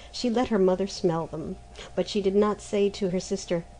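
usual speaking voice

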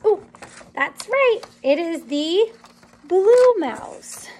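ordinary delivery, nothing marked

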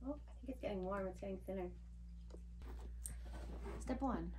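ordinary talk

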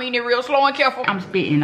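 muffled behind hand; talking while chewing. Real, not acted. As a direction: mouth partly full. Seen 2: holds taco plate, then mouth working and hand covering it.

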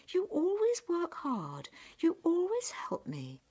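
high-pitched, approving storybook mum; praising mother. Acted. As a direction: praise your daughter in a high voice. Mother praises her good daughter.

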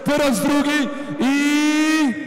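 high drawn-out auction calls building; auctioneer. Real, not acted. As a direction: stretch each call for suspense. Auction climax.

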